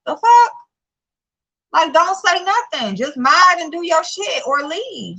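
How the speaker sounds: high-pitched indignant telling-off; exasperated scolding. Real, not acted. Vlogger telling a moderator to stay out of it, exasperated.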